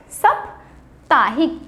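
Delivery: साप्ताहिक drawn out slowly, rising at the end; साप्ताहिक stretched and clear; slow careful pronunciation. Shown as a demonstration. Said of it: "पर साप्ताहिक नहीं होता है".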